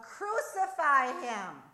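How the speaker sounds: very loud, high, slow yell. A narrator's reading. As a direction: bellow it over the crowd. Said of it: "Even louder, the cries came".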